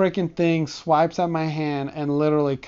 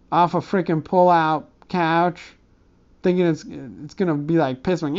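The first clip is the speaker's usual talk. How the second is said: usual speaking voice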